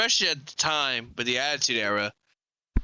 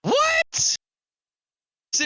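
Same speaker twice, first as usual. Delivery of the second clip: very high, loud squeak of disbelief; incredulous squeal; 4 dB louder than usual. Real, not acted. Co-host hears the originator claim, incredulous squeal. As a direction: shoot way up high in real disbelief.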